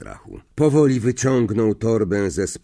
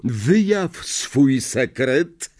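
slow, higher, drawn-out command; 5 semitones higher, 3 dB louder, much slower than usual. A narrator's reading. Professor commanding the parchment, slow and imperious. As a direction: intone it like a spell. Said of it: "rozkazał".

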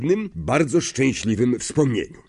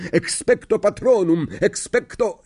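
roaring the spell; 10 semitones higher than usual. Acted. Harry faces the Dementor, roaring the spell. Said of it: "ryknął Harry".

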